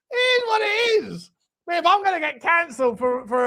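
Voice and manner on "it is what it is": high sing-song. Shown as a demonstration. Repeats the phrase higher.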